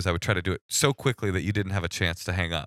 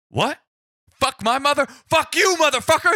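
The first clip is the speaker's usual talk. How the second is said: much higher, louder shouted outrage; enraged cop; 7 dB louder than usual. Retold or imitated. Police officer shouting back at the caller, furious. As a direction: explode like the insulted cop. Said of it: "the cop fucking goes".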